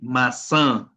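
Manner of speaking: "maçã" with a nasalised final vowel; nasal vowel. Shown as a demonstration. Teacher giving an example of the tilde, nasal ending on "maçã". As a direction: let the end of "maçã" ring through the nose. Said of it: "con un sonido nasal".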